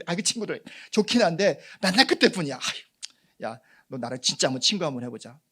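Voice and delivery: loud, high eager offer; eager friend. Retold or imitated. Friend asking to be friends, eager and loud. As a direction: urge the friendship warmly and loud. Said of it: "지금 말로 어떻게 말할 수 있나요".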